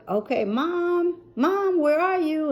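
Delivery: high, searching call through the house; calling for mom from room to room. Retold or imitated. Daughter calling out to find her missing mom.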